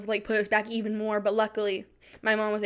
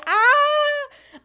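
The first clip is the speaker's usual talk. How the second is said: high yelp; 8 dB louder than usual. Real, not acted. Outburst of fear.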